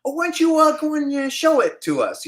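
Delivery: casting guy interviewing the actor, high and smarmy; sleazy casting guy. Retold or imitated. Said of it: "he's like".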